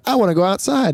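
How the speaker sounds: put-on high whiny child voice; a kid begging to go play; little kid pleading. Dad imitating his son asking to go outside.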